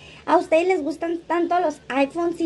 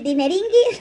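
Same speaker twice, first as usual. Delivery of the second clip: mild mock yell of Dineringuis; hammed-up shout of Dineringuis; 4 semitones higher than usual. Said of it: "dice que grita algo así".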